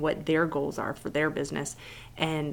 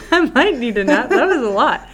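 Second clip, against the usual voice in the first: laughing; 9 semitones higher, 9 dB louder than usual. Real, not acted. Laughing after long talk.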